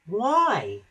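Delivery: high, drawn-out baffled question; puzzled. A narrator's reading. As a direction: ask it in puzzlement. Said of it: "He was very puzzled".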